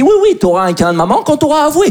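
interrogatoire exaspéré, très haut perché; père inflexible. Acted. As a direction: joue le père qui exige des aveux, voix qui monte. Père interrogeant sa fille comme flic. (père excédé, voix montée dans les aigus)

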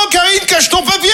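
ordinary talk, nothing marked

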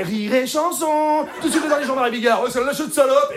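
high, excited station voice; radio announcer interruption. Acted. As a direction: cut in like a radio jingle. La radio coupe la chanson. (voix d'animateur radio, aiguë)

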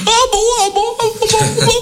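very high, squeaky "ah bon"; squeaky surprise. Acted. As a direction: gasp it out high like a startled priest. Priest reacts again. (petits « ah bon » suraigus et ahuris)

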